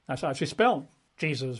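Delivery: ordinary talk, nothing marked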